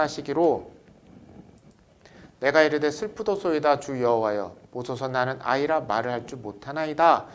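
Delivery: loud, measured scripture recitation; scripture reading. Acted. Teacher leading the class in a scripture reading, loud and measured. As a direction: read it out like the pulpit Bible. Congregation reads scripture aloud.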